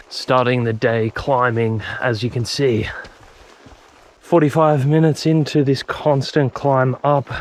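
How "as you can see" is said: ordinary talk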